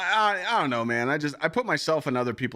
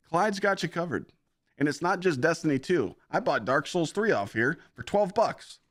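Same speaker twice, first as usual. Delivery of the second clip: higher, bouncy pitchman voice; mock ad-read voice; 9 semitones higher, 4 dB louder than usual. Streamer hamming up a G2A sponsor read, higher pitchman voice. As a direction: hype it up like a cheesy commercial. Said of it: "I'm just talking like this".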